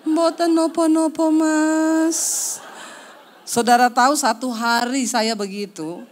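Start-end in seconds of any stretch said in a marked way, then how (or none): 0.0-2.6 slow, sweet exaggerated Javanese wife voice; put-on polite Javanese. Wife waking husband in mock-polite Javanese. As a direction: drawl it out like a dainty Javanese wife. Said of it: "aku koyo wong Jawa".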